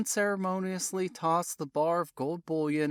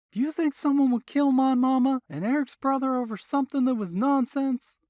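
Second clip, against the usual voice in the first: higher, louder, fast bitter outburst; angry daughter, higher and rushed; 9 semitones higher, 6 dB louder than usual. A narrator's reading. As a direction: fire it back at him, hurt and angry. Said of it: "Jesse added bitterly".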